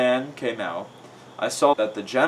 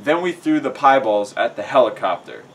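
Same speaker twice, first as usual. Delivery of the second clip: ordinary delivery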